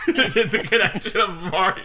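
high, giggly laughter; laughing. Real, not acted. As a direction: laugh through the words. Laughing at the Mario joke.

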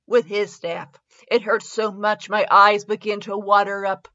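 ordinary talk, nothing marked

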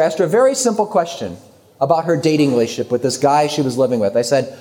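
ordinary delivery

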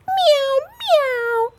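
very high kitty meow; meowing cat. A narrator's reading. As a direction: mew high like a little cat. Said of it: "answered the cat".

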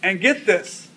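normal talking voice

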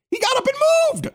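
high-pitched, exasperated disbelief; incredulous outrage. Real, not acted. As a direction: exclaim it way up high, scandalized. Outraged punchline of retold incident.

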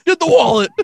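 high, slow, drawn-out hype. Pretend. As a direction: announce it like a big event. Cookie season hype voice.

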